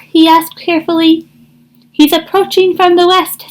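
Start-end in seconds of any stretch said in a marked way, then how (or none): 1.9-3.5 Bluebird's voice. Acted. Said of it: "said Bluebird".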